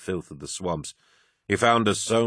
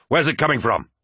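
raised, higher-pitched demand; bellowed question; 6 semitones higher, 4 dB louder than usual. A narrator's reading. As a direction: call it out over gunfire. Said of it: "bellowed Horace".

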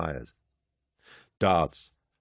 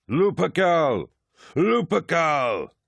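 high, ringing war shout; battle cry; 10 semitones higher, 4 dB louder, slower than usual. A narrator's reading. Warriors answer their commander with a high battle cry. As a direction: chant the name like a war cry, second one drawn out.

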